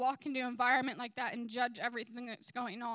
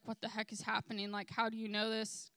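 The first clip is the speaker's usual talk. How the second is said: ordinary delivery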